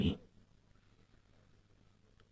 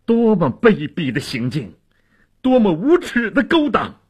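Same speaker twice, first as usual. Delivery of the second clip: high-pitched outraged denunciation; indignant outburst, climbing high; righteous indignation; 9 semitones higher, 3 dB louder than usual. A narrator's reading. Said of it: "义愤填膺地说".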